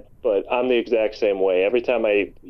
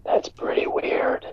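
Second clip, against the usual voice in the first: hushed mock-ASMR whisper; soothing ASMR whisper; 4 dB quieter, slower than usual. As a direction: whisper the insult soothingly, close to the mic. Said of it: "the soothing A S M R voice".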